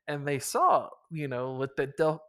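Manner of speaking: normal talking voice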